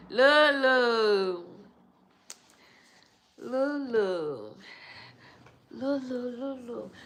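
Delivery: loud sing-song calling of the name. Real, not acted. Vlogger calling a viewer's name playfully.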